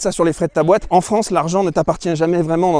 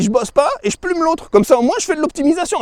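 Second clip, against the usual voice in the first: mock quote; 11 semitones higher than usual. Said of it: "elle a dit".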